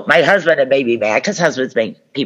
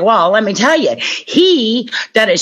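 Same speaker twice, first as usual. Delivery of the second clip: eager to blame; 10 semitones higher than usual. Retold or imitated. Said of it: "And I'm like".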